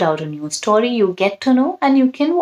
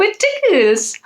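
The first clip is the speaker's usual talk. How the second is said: a wardrobe squirming from tickles; giggly wardrobe; 12 semitones higher than usual. A narrator's reading. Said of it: "said the wardrobe".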